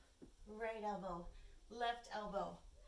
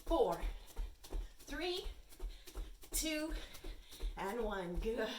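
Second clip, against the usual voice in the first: loud pumped-up coaching; 2 semitones higher, 6 dB louder than usual. Real, not acted. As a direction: pump the class up, louder. Cardio high knee run cues.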